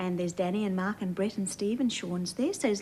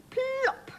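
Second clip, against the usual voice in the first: very high popping plop; high comic sound word. A narrator's reading. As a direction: pop it out like a cork.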